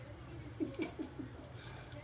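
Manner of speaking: quiet, breath-only laugh; real hushed giggle; soft chuckle. Real, not acted. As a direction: chuckle softly to yourself. Reader amused by Leaf Guy.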